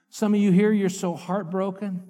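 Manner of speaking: usual speaking voice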